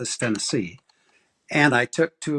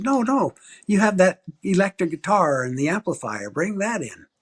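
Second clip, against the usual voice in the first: higher, eager mock bishop; bishop insisting; 7 semitones higher than usual. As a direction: imitate the bishop brushing off worries.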